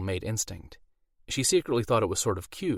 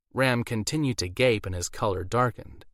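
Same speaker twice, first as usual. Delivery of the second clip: ordinary delivery, nothing marked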